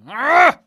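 loud, high drawn-out goal scream "aah"; long goal yell. Retold or imitated. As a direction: yell it like a striker celebrating a goal. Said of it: "¿Cómo lo gritó el gol".

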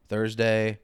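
ordinary talk

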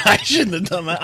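a laugh breaking into the apology. Real, not acted. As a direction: say sorry while barely holding a laugh.

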